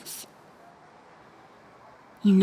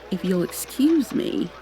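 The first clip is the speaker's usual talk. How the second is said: ordinary delivery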